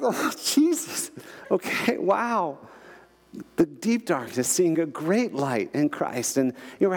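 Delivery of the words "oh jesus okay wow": high, slow mock-tearful voice; overwhelmed, tearful retelling in a high pitch; retold bawling. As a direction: imitate being choked up, high and drawn out. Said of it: "just bawling".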